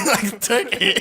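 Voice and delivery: laughing. Real, not acted. Copying the word turkey.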